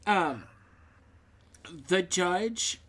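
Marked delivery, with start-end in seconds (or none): none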